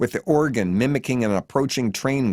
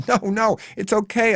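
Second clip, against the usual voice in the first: high-pitched, urgent plea; begging; 10 semitones higher than usual. A narrator's reading. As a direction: beg her to stop. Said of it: "I beg".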